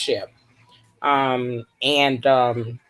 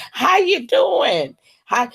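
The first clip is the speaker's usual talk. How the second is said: much higher, slow, sing-song how you doing; sweet greeting; 8 semitones higher, slower than usual. Real, not acted. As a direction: coo a greeting to the viewer. Greeting viewer.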